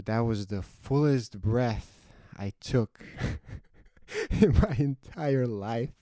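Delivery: slow, drawn out with a laugh; slow, relieved. Real, not acted. Telling friends about his first jump, slow and laughing. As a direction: savor the memory slowly, laughing. Relief after the parachute opens.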